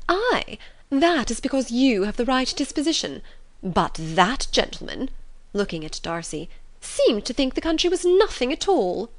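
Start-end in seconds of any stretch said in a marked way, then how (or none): 0.1-5.1 higher, emphatic matron's voice; needling mother. Acted. Mother approves host, singles out other gentleman, pointedly needling. 6.8-9.1 much higher, indignant matron's voice; affronted mother. Acted. Mother accuses gentleman of scorning the country, affronted. Voices Mrs Bennet needling Darcy.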